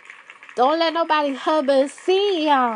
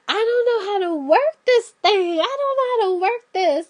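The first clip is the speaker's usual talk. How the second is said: lightly voiced mother; 6 semitones higher, 3 dB louder than usual. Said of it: "the mother was like".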